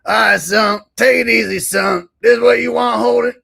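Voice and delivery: put-on high, loud old man shouting; loud hammed-up old man. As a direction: bellow at Holden in character.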